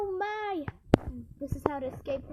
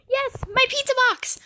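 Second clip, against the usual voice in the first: excited shout; 5 semitones higher, 7 dB louder than usual. Real, not acted. Winning pizza box.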